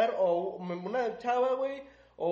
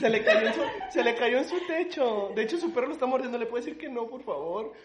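súplica fingida; 5 semitones higher than usual. Acted. Actúa la súplica a la señora.

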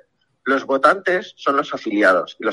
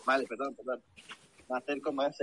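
faint, distant speakerphone voice; talking from far off the phone; 14 dB quieter, faster than usual. Real, not acted. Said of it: "no sé si estás en altavoz o algo".

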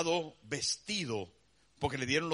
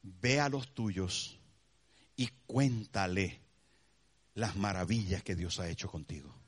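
much lower, slow and soft; Jesus sending him; 11 semitones lower, 4 dB quieter than usual. Acted. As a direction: gently send him home. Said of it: "¿Sabe qué le dice?". (Jesús lo envía, voz baja y lenta)

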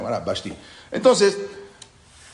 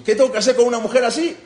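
furious question; 10 semitones higher, 8 dB louder, faster than usual. Retold or imitated. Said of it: "la furia lo envolvió".

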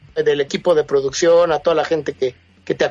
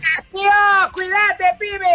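much higher, playful put-on Spanish voice; mock Spanish slang; 14 semitones higher, slower than usual. Host's guest mocks his Spanish side with high Spanish slang. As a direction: tease him with a fake Spaniard's tío. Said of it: "Ah, no, tú eres español".

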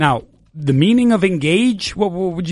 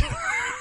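sudden burst of real laughter; laughing; 12 dB quieter than usual. Real, not acted. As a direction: crack up at your own joke. Teacher cracks up at own joke.

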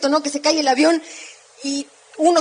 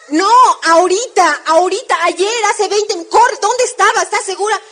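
eager urging; 5 semitones higher, 6 dB louder than usual. Retold or imitated. Friend urging to go now.

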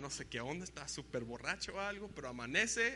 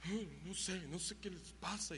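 whiny complaint; 6 dB quieter than usual. Retold or imitated.

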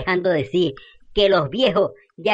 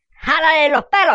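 high, loud egging-on with a laugh in it; gleeful shout; 9 semitones higher, 5 dB louder than usual. Acted. Fidela urging neighbours to pull her hair, loudly gleeful. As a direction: cheer the fight on, loud and delighted. Eggs on a hair-pulling fight.

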